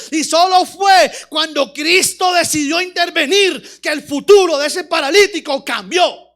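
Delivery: loud, driving sermon delivery; preaching at full force. Real, not acted. Preacher rebuking the paralytic's passivity, full-force preaching. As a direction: hammer the key words, preacher-style.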